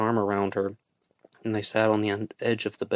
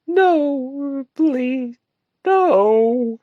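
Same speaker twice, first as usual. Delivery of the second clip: high, loud tearful wail; 9 dB louder, much slower than usual. A narrator's reading. Woman refusing to leave, wailing. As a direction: wail no over and over. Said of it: "she wailed".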